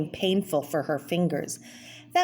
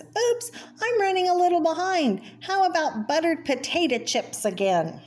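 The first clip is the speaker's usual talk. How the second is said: much higher, sheepish cartoon voice; busy wife cartoon voice; 11 semitones higher than usual. Cartoon Anna telling her husband dinner is late, sheepish high voice. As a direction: sing out a cheeky apology. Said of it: "And she says".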